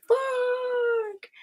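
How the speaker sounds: a high, drawn-out imitated "Fuck"; mock Nadine swear. Reader imitates Nadine reacting in chat, high mock swear. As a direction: squeal it like the friend in chat swearing. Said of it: "Nadine says".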